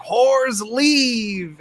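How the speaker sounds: high-pitched mock scream, held back in volume; stretched, high imitation of an angry command; Dracula's imitated scream. Vampire banishing the concubines, high mock scream. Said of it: "he's just screaming".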